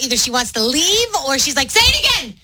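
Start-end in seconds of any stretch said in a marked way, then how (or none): 1.7-2.3 much higher, frantic demand; mock freaking out. Girlfriend who half-heard a sleepy confession, hammed-up frantic demand. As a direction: imitate a partner urgently begging to hear it. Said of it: "she's, like, freaking out".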